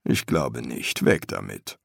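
unimpressed master embalmer; master embalmer, dry. A narrator's reading. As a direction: say it low and measured, as a seasoned master.

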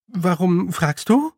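hesitant and mildly desperate; understated despair. A narrator's reading. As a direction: ask with a touch of desperation. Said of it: "entgegnete Tiffany verzweifelt".

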